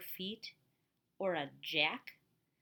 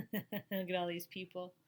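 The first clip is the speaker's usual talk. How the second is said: plain reading voice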